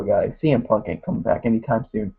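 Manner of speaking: ordinary delivery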